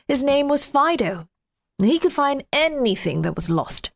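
usual speaking voice

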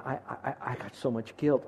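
soft stammering guilt; retold sobbing. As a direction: quietly voice her broken words. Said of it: "And she's sobbing, saying".

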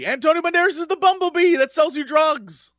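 high, exasperated explaining; exasperated insisting. Real, not acted. Host explaining the bumblebee to baffled co-hosts, exasperated insisting. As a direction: insist as if it were common knowledge.

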